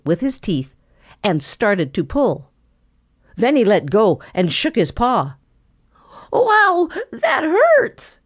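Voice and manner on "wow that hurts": crying out in pain. A narrator's reading. Boxer crying out with tears in his eyes. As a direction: cry out as the quill tugs. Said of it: "he cried".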